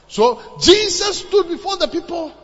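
loud, high proclamation; rousing preaching. Real, not acted. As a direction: proclaim it forcefully to the crowd. Sermon climax, raised voice.

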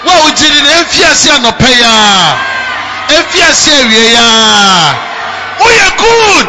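loud, climbing preacher's proclamation; full-force preaching. Real, not acted. Preacher blessing the congregation, full-force preaching. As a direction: build the blessing to a shout.

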